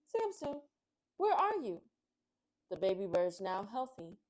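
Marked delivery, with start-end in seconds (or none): 0.1-1.8 high, loud searching call. Acted. Butterfly calling for missing Samson, high call. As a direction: call her name across the field. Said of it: "called out".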